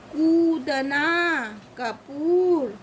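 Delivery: कूदना and कपूर slowly, oo stretched; long oo. Shown as a demonstration. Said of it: "बड़े ऊ की मात्रा लगी होगी तो कैसे".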